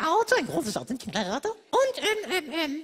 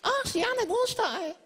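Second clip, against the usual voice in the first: mock surprise; 5 semitones higher than usual. Mock surprise about egg.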